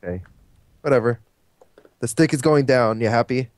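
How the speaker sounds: normal talking voice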